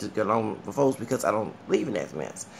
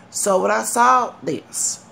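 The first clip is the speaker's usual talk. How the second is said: slow, high Southern drawl on the words; Southern accent; 8 semitones higher, 5 dB louder, much slower than usual. Real, not acted. Vlogger lapsing into a Southern accent while telling fans, Southern accent. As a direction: let the Southern accent slip out. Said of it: "the Southern accent just came out of me".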